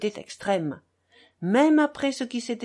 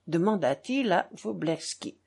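ordinary delivery, nothing marked

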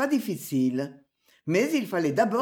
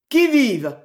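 loud, raised sentry's call; sudden challenge; 5 semitones higher, 8 dB louder than usual. A narrator's reading. Sentry waking to challenge intruders, sudden loud call. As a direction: call out sharply, startled awake. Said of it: "demanda soudain le factionnaire".

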